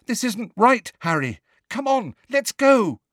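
high, loud, anxious plea; scared urging. A narrator's reading. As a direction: urge a friend away, alarmed. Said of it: "She sounded scared".

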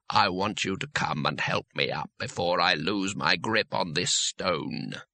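a hollow voice from below, spelling it out slowly; patient disembodied voice. Acted.

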